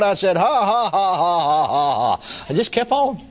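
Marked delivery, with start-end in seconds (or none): 0.4-2.5 mechanical ha-has sliding downward; forced laugh. Retold or imitated. Sick man answers devil with forced laughter. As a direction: force out a row of ha-has, falling. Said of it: "flat made myself do it".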